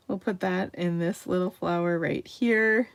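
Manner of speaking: ordinary talk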